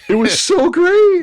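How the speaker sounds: high, loud, slow and thrilled; thrilled kid. Retold or imitated. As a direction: gush like a delighted child. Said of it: "And Evan's like".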